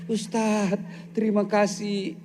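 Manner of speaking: touched wife. Acted.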